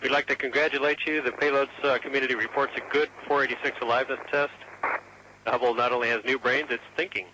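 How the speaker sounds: astronaut radio. Real, not acted. Houston calling over radio.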